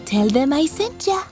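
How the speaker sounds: ordinary delivery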